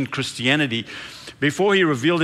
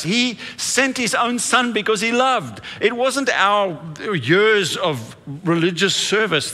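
building preacher intensity; 4 semitones higher than usual. Acted. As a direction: lift the congregation with growing fervour. Preaching builds on God's love.

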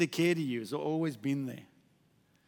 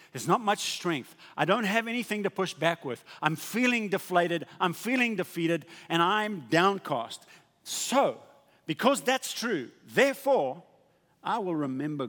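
raised, emphatic sermon delivery; forceful preaching; 5 semitones higher, 6 dB louder than usual. Real, not acted. Preacher voices the downcast psalmist for the congregation, forceful preaching. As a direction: build it with insistence toward the turn.

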